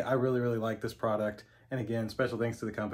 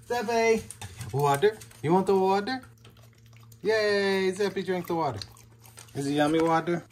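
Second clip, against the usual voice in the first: pet talk; 10 semitones higher, 7 dB louder than usual. Real, not acted. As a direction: sing it up high to tempt the dogs. Praising dog drinking.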